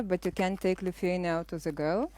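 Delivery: ordinary delivery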